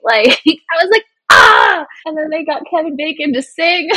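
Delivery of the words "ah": high, loud "ah" cry; re-enacted squeal of being moved; emotional squeal. Own squeal at the sweet moment.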